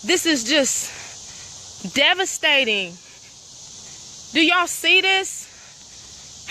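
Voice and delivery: slow, heavy, drawn-out dismay; heartbroken. Real, not acted. Showing viewers the torn-up trail, slow and heartbroken. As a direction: let each word sink with hurt. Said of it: "my heart is hurting so bad".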